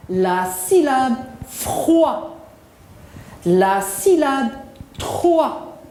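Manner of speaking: very slow measured chant-like drill; model for repetition. Shown as a demonstration. Teacher drills syllables for pupils to repeat, slow and deliberate. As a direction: stretch each phrase, setting the final syllable apart. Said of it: "Répétez après moi".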